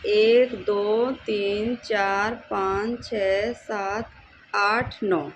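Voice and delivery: counting slowly, one by one. Shown as a demonstration. Teacher showing how to count the squares, slow deliberate counting. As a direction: count each number out deliberately. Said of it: "मतलब गिनती करना है".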